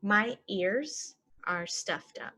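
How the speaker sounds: slow and even; slow model. Shown as a demonstration. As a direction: say it slowly for learners. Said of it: "normal then slow".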